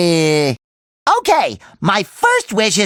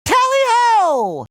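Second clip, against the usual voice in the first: high, drawn-out charging call; rallying cry; 9 semitones higher, slower than usual. Acted. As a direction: call it out gleefully, slowly.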